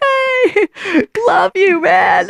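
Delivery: very high, beaming, laughing greeting; cheery overjoyed greeting. Retold or imitated. Answering a grumpy person with beaming, high-pitched cheer. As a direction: gush love at a grump. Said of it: "And you're like".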